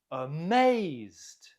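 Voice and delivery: demonstrative gasp, then a stretched Amazed; gasp, then swell on Amazed as if astonished; acted amazement. Shown as a demonstration. Acts out meaning of astonished.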